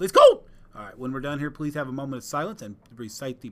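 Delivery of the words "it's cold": playful high squeal; squeaky high exclamation. Real, not acted. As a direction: jump way up and loud on it. Echoing colleague.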